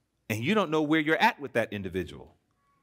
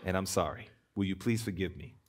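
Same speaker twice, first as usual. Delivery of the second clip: softer, lower, sincere plea; humble apology; 7 semitones lower, 4 dB quieter than usual. Pretend. Preacher modelling a spouse's heartfelt apology, soft and sincere. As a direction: let it fall quiet and humble. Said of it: "It's like".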